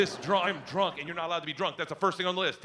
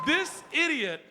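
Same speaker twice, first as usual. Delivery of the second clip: higher, slow mock-shout; exasperated exclamation; 10 semitones higher, slower than usual. Real, not acted. Comedian ranting to audience, exasperated shout. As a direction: bellow the insult slowly. Comic outburst.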